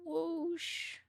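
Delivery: high whoosh that breaks; voice-breaking whoosh. Real, not acted. Tracing bat wings while drawing, cracking whoosh. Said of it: "my voice is breaking while I say whoosh".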